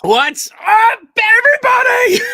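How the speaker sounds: big, high, loud hello to the chat; hyped stream opener shout; excited greeting. Real, not acted. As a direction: throw the welcome out with huge energy. Stream host greets viewers.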